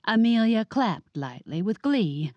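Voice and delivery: plain reading voice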